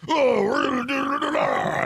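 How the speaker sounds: Rambo voice. Retold or imitated. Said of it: "he's like Rambo".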